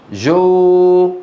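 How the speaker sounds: جو stretched as joooo on a level note; held long o. Shown as a demonstration. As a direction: draw out جو as a pure o. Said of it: "ما في أو بالعربي".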